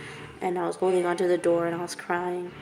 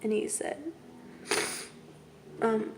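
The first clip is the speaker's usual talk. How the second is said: real near-tears, voice thinning to a whisper; fighting back tears; 11 dB quieter than usual. Real, not acted. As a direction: choke it out between long pauses, holding back crying. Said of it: "trying so hard not to cry".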